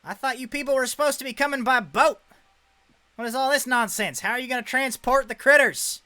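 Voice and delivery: high, loud, fast carnival boss bellowing; loud exasperated animal dealer; brash showman. Acted. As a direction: blast it out like a cocky showman. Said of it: "and he goes".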